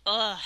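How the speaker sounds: loud retching ugh; disgusted groan. Real, not acted. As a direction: gag at the taste. Gross taste.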